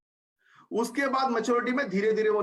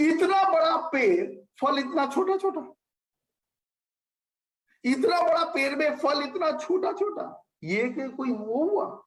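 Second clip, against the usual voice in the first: milk the absurdity of big tree, small fruit; storyteller hamming up the complaint; mock indignation; 5 semitones higher than usual. Mocking the tree's small fruit.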